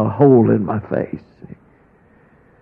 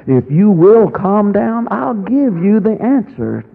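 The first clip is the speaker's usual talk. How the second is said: lifted promise; 7 semitones higher than usual. Retold or imitated. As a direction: lift it up like a voice from above. The Lord promising an answer.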